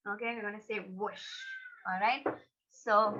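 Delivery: plain reading voice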